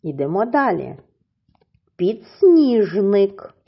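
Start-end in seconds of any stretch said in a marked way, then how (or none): 2.0-3.5 "Підсніжник" drawn out very slowly and a bit louder; slow model word. Shown as a demonstration. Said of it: "Ділимо на склади".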